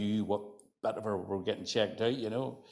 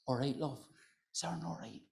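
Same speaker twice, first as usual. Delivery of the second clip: much quieter, gentle Larne lilt; softer Larne voice; 5 semitones higher, 9 dB quieter than usual. Retold or imitated. A Larne woman checking on a visitor, softly. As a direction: soften it right down, kindly. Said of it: "it softens a wee bit".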